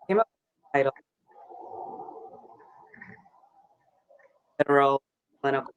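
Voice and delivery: choppy audio cutting out; broken-up video call sound; glitching audio. Real, not acted. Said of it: "Could you repeat that? Your audio is cutting out".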